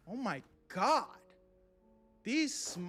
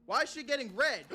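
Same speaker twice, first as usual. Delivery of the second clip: loud, higher alarmed yelling; startled by something going wrong; frantic whoa whoa whoa; 5 semitones higher, 7 dB louder than usual. Real, not acted. Customer's face turning red.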